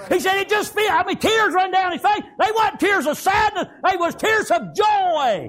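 shouted testimony of tears of joy; preaching shout. Acted.

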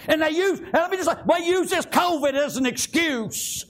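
high-pitched, urgent revival preaching; impassioned country preacher in full flow; fervent preaching. Real, not acted. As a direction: exhort the church in driving bursts.

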